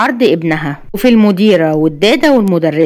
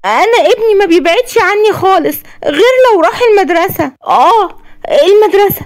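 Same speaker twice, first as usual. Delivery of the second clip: higher voiced anxious mother, pleading; a mother explaining her son's fear of school; worried mother; 11 semitones higher than usual. Worried mother tells the doctor about her son, anxious and pleading.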